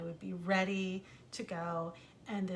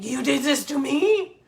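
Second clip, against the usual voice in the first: put-on loud, higher, stunned French-accented outburst; angry accusation; 6 semitones higher, 13 dB louder than usual. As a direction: whirl round and shout the accusation. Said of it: "he whirled around on me".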